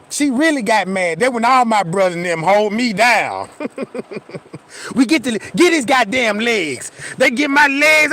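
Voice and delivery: ordinary delivery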